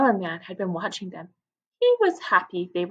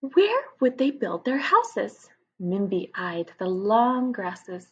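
put-on lively read-aloud for children; story narration; 4 semitones higher, 5 dB louder than usual. A narrator's reading. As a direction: tell it to a class of young kids. Reads story aloud to class.

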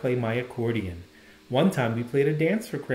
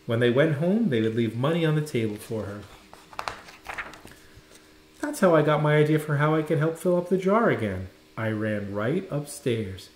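ordinary delivery

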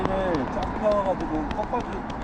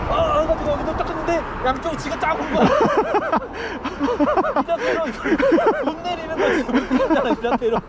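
laughing while telling; 7 semitones higher, 4 dB louder than usual. Real, not acted. Laughing retelling leg cramp story.

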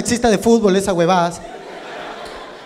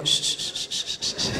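soft unvoiced shushing; 15 dB quieter, slower than usual. Woman hushing him after sex, soft whispered shushing. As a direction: hush him gently, breath only. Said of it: "Me dijo".

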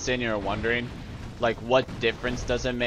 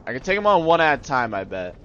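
normal talking voice

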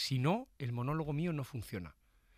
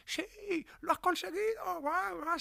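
high excited crowd cheer; slower than usual. Retold or imitated. Comedian imitating his audience cheering, high and excited. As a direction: play the whole audience going wild. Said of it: "la peña empieza a decir".

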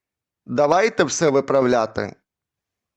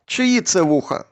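ordinary delivery

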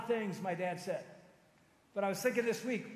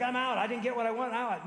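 louder, higher, quick impatient quitter; mock quitter; 4 semitones higher, 6 dB louder than usual. Preacher mimics today's quitters to the church, indignant. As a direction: mimic someone giving up in a huff.

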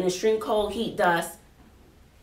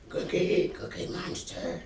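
soft, lower whisper; hushed awe; 5 semitones lower, 8 dB quieter than usual. Real, not acted. Teacher whispering the name of a Cookie Monster gift.

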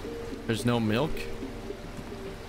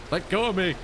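sharp high yell to break free; shouting; 9 semitones higher than usual. Acted. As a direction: yell it, yanking away. Pulls hand away from creep.

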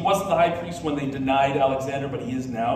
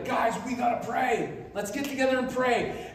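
gather everyone up with a raised voice; urgent rallying call; 7 semitones higher than usual. Acted. High priest calling his people to prayer, urgent and high.

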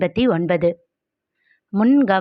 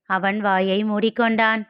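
ordinary delivery, nothing marked

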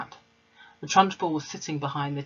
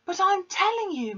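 put-on very high, loud child's shout; girl shouting back at headmistress; 5 dB louder than usual. A narrator's reading. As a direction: refuse to back down. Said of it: "Matilda shouted".

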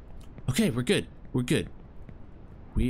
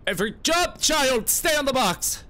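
raised, high-pitched exasperation on every word; stressed scolding; 10 semitones higher, 3 dB louder than usual. Real, not acted. Player ordering his game character to stay on the box, exasperated. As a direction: lift the pitch and push it out, tense.

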